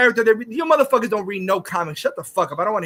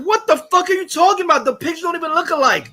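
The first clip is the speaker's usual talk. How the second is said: higher-pitched retold outrage; exasperated retort; 7 semitones higher than usual. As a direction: argue back in high, incredulous exasperation. Retold retort to Pattinson picture claim.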